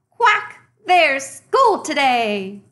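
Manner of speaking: high, loud quacking duck voice; duck calling. Acted. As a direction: call it out like an excited little duck. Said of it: "Little duck called".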